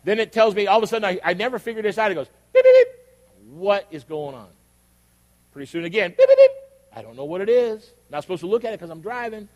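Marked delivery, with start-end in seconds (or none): 2.5-2.9 high, loud electronic beeping; imitated alert beeps. Preacher imitating the Waze alert, piercing beeps. 6.2-6.5 high, loud electronic beeping; imitated alert beeps. Preacher imitating the Waze alert, piercing beeps.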